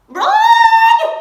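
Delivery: very high, loud panicked shout; scream. Acted. Mother Mouse warning her babies, high panicked scream. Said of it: "screamed Mother Mouse to her babies".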